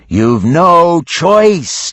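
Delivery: John Drowse yelling to Mischief. A narrator's reading. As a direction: shout the hard truth. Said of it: "John Drowse yelled".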